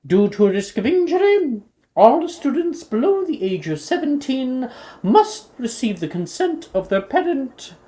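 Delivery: loud, slow formal school announcement; headmistress announcement. Acted. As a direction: proclaim the school events grandly.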